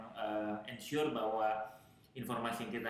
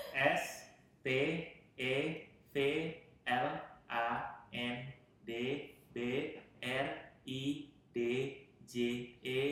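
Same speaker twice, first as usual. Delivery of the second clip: letters one by one, with pauses; spelling it out; 3 dB quieter than usual. Shown as a demonstration. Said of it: "Coba saya eja aja pelan-pelan".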